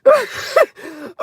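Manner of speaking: high squeal breaking into laughter; helpless laughter. Real, not acted. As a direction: squeak it out, overcome with giggles. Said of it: "Okay, I gotta calm down".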